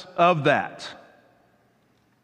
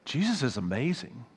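sincere devotion; 3 semitones lower, 8 dB quieter than usual. Retold or imitated. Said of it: "You go".

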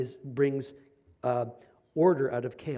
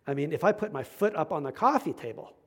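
normal talking voice

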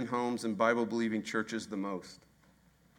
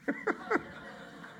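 breathy laugh; 5 dB quieter than usual. Real, not acted. Laughs at own story.

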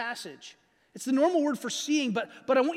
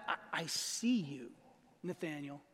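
hushed and slow, much softer and lower; tender; 7 semitones lower, 11 dB quieter, slower than usual. Acted. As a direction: say it gently, as if to one person close by. Jesus tenderly addressing Nathanael.

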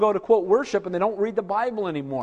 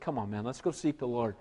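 lower, much softer invitation; gentle; 7 semitones lower, 11 dB quieter than usual. Retold or imitated. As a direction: coax a friend quietly. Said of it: "but he said".

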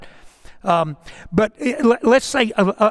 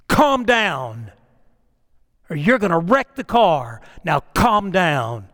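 usual speaking voice

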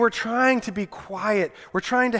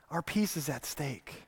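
much softer, lower, letting it sink in; soft echo; 3 semitones lower, 12 dB quieter than usual. Shown as a demonstration. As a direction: repeat it almost under the breath. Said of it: "repeated after plain take".

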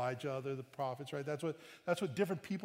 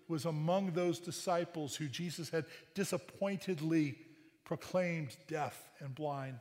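ordinary talk

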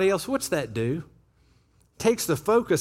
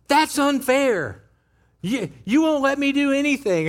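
imitating a yelling kid; 5 semitones higher than usual. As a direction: whine it like a kid yelling at mom. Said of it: "her kid is yelling at her".

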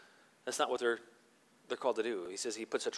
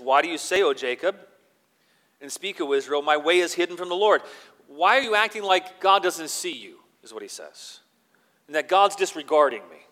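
forceful preaching; 3 semitones higher, 10 dB louder than usual. Acted. Preacher reading Isaiah to the congregation, booming proclamation. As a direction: proclaim it to the whole room.

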